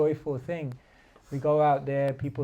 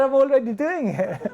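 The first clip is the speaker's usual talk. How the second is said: higher, louder, laughing through the punchline; laughing boatman; 9 semitones higher, 7 dB louder than usual. Retold or imitated. Boatman answering the businessman, laughing and louder. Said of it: "He said".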